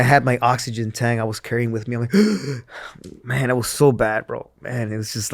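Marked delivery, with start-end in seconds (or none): 2.2-3.2 imitated gasp. Out of breath jogging.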